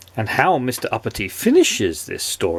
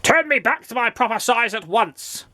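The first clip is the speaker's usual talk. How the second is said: high-pitched indignant outburst; spluttering rage; 10 semitones higher than usual. A narrator's reading. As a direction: demand it in pompous outrage. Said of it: "spluttered Mister Uppity in a rage".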